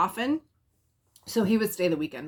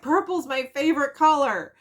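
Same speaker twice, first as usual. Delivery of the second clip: higher, louder mock tearful cry; imitating tearful joy; 8 semitones higher, 5 dB louder than usual. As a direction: play her choked up over the purple clothes. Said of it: "she started crying".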